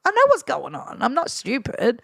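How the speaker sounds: high, knowing clapback; mock sassy retort; sassy comeback. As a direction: put the heckler in his place. Retold comeback to heckler.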